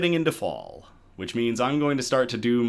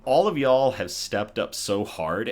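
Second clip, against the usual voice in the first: ordinary talk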